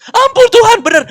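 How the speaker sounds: high, loud retold wail; begging for mercy. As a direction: cry out to God in exaggerated desperation. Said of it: "Sampai berlutut nangis-nangis". (teriakan memohon ampun yang didramatisir)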